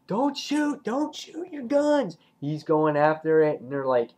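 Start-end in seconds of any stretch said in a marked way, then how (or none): 0.1-2.2 high-pitched urgent warning; urgent calling out. Retold or imitated. Rick warns the group during the attack, high urgent call. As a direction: call out an acted order in alarm. Leader calls out an urgent order.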